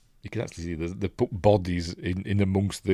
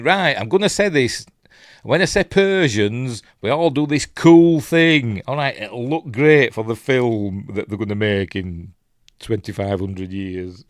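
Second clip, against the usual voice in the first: louder, higher hammed-up commander; joking imagined pep talk; 3 semitones higher, 6 dB louder than usual. Leonidas coaching his men for the film, hammed-up loud. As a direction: play the king planning his cool movie moment.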